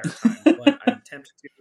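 bursting into laughter mid-sentence; laughing. Real, not acted. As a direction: laugh through the words. Laughing while joking about pirate voice.

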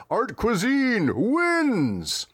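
high, hugely stretched victory call; game announcer. Acted. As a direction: draw out each word like a fight announcer. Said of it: "The announcer, uh, spouts".

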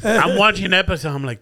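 usual speaking voice

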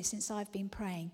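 ordinary delivery

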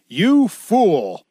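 stern scripture rebuke, lightly acted; harsh rebuke. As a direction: read it as a drawn-out, mildly harsh put-down. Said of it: "Paul responds quite harshly".

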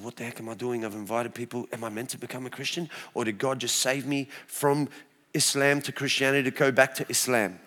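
quiet anxious inner voice; soft panicked self-talk; hushed worried thoughts. Retold or imitated. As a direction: mutter the doubts to yourself. Retelling inner panic before baptism.

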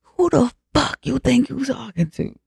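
mock-furious comeback, held in volume; acted losing it. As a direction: imitate outrage with the pitch thrown high. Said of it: "Yeah, she lost her shit".

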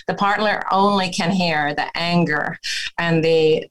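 normal talking voice

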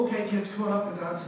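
a hint of chirpy kids' church host; mock children's leader. Imitating a leader calling children up to dance, mildly sing-out.

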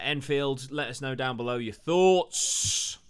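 loud, high sign-off with a drawn-out last word; calling out. Real, not acted. Host asking viewers for comments at episode's end, loud drawn-out call. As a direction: call it out to the viewers, stretching the ending.

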